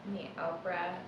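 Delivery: soft, unhurried meditation guide; hushed meditation voice trailing into a whisper; guided meditation. Acted. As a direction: ease them into noticing the breath, gently.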